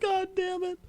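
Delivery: high, breathless laugh-cry; laughing till crying. Real, not acted. Host gives up on the topic, laughing until near tears. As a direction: crack up helplessly through the words.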